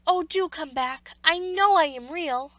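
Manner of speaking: pleading call. A narrator's reading. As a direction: beg them to return. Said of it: "called the little rabbit".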